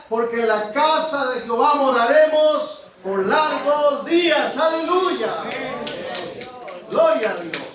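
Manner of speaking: full-force pulpit shout of praise; fiery preaching. Acted. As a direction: proclaim it to the whole congregation. Preaching climax with aleluya.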